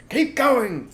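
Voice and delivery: high, loud urging of the digger; loud high farmer's call. Acted. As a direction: push the men on. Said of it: "said Fat Bogus".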